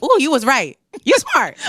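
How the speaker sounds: high, loud mock praise; sassy Ooh, you were right; sheepish admiring. Singer admits God was right, high and loud. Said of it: "And I'd be like".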